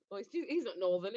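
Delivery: normal talking voice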